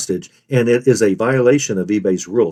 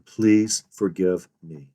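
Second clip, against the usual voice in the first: "please forgive me" slow and softened, word by word; slow, soft "please forgive me" as a plea; stressed remorse; 5 semitones lower, 5 dB quieter, much slower than usual. Shown as a demonstration. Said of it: "stress each of the following three words".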